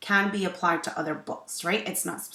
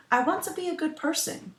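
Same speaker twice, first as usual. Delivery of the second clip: put-on boy's voice; 12 semitones higher, 5 dB louder than usual. Boy character Nicoali speaks.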